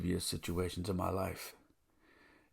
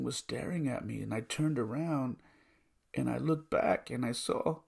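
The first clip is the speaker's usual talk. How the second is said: choked up; 8 semitones higher than usual. Real, not acted. Choked up retelling son's vision.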